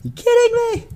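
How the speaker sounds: high-pitched squawk of mock outrage; imitating a furious buddy, voice shooting way up; mad, retold. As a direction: squeal it in exaggerated disbelief. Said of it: "He was so mad".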